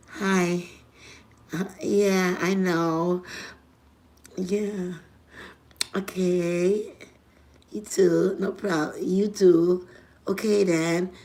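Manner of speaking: quiet secret call answers; man on the phone. Retold or imitated. Man murmuring softly into the phone late at night. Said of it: "And your man talk like that".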